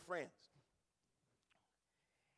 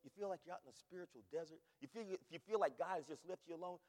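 ordinary talk

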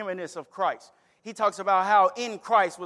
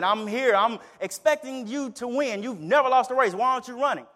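higher, louder furious pleading with the dog; mad and upset; 4 semitones higher, 4 dB louder than usual. Acted. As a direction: demand answers from the dog, exasperated. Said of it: "profusely mad and upset".

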